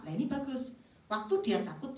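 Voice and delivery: ordinary delivery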